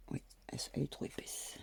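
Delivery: quick whispered aside to herself. Real, not acted. Annoyed at the thick pin, she whispers to herself. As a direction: breathe it out fast under your breath.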